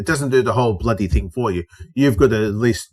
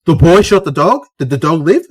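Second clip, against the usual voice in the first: raised, incredulous questions; mock-shocked, voice pitched up; imitating appalled disbelief; 5 semitones higher, 5 dB louder than usual. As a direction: mimic a listener aghast at the story. Appalled workmates question the story.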